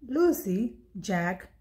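ordinary talk, nothing marked

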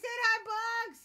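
high, loud little greeting voice; squeaky-high imitated hi. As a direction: mimic a high-pitched hello to a pet. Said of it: "they said".

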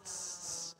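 soft, repeated "tss" noises; quiet sibilant spurts; imitated leak hiss. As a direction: mimic water hissing from a leak. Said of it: "it's leaking water".

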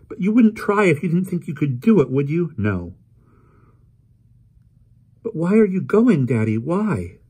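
put-on high, loud pleading girl's voice; upset young girl. Polly pleading with her father, high upset girl. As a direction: beg her father for an answer.

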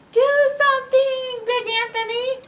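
high, loud panicked plea; shouted. A narrator's reading. The frightened townspeople shout at Big Anthony, high loud plea. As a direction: cry out to him for help. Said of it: "they shouted".

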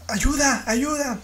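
high, pleading cry for help; Sandra's plea for help. Retold or imitated. Caller on the emergency line, pleading high. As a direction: imitate the desperate caller begging.

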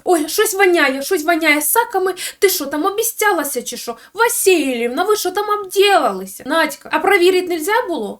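hammed-up griping about a stink; mock disgusted whine. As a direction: whine in disgust like a fussy son. Mimicking the son complaining of smell.

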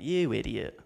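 a hint of a scornful put-down; mock blunt insult. Telling off a mourner as a joke, lightly scornful. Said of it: "be like".

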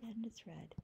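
whispered aside. Real, not acted. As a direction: whisper it quietly. Soft aside.

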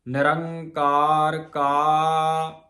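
slow, drawn-out chant of gurbani; slow scripture recitation. Shown as a demonstration. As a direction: recite with a pause after ਨਿਰੰਕਾਰ. Recites scripture line slowly. (Gurbani ਦਾ ਹੌਲੀ ਪਾਠ)

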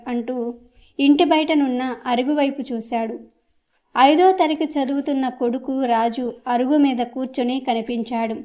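ordinary delivery

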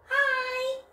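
tiny high puppet hello; squeaky puppet voice. Acted. Teacher voicing the puppet dog's hello to the children, high and squeaky.

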